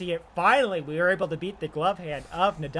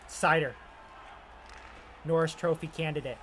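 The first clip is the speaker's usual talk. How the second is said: ordinary talk, nothing marked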